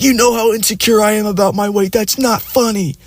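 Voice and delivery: high-pitched imitation of a screaming girlfriend; mock screaming. Offended girlfriend screaming at boyfriend's joke, mock screaming. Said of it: "she just starts screaming".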